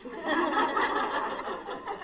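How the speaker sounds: bright high giggle; high laugh. Real, not acted. Laughs at own joke.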